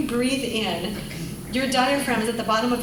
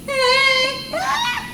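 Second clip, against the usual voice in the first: tight strained squeak; 4 dB louder than usual. Shown as a demonstration. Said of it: "get tight".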